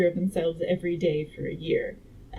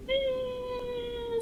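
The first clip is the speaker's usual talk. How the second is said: long, high, soft falling wail; mock falling scream; 6 dB quieter than usual. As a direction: imitate someone plummeting from a great height. Imitates a baby falling before splat.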